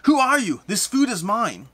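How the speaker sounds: high, loud possessive outburst; screaming ghost. Acted. Ghost screaming at intruders over his food, high and possessive. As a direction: shout it high, jealously guarding your hoard. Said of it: "he keeps screaming at you".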